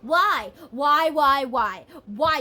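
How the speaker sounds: hammed-up cry of why; mock wailing why. Querent begging the universe for reasons, loud mock wail. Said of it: "I'm hearing why".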